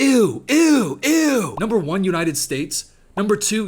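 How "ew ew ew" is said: high, loud repeated ew; mock disgust. Reacting to his own top five countries, loudly disgusted. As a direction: recoil in exaggerated disgust.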